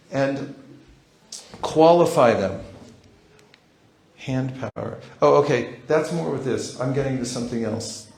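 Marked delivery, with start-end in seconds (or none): none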